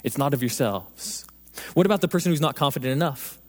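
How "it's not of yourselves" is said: slow, softer voice of God; a gentle promise, eased out slowly; God reassuring. Retold or imitated. God comes back to the humbled man, soft and slow. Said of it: "he says".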